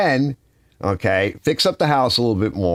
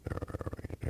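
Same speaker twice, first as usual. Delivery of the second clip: whisper; 20 dB quieter than usual. Real, not acted. Muttering to himself.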